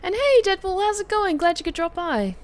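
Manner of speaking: high, bright hello; cheerful greeting. Real, not acted. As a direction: greet them with delight.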